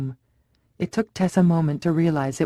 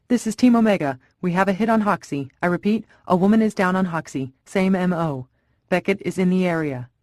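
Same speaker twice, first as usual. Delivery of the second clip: urgent radio call; 3 semitones higher than usual. Acted. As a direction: bark the report fast over the walkie. Urgent police radio report.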